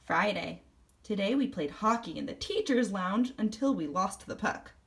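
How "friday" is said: plain reading voice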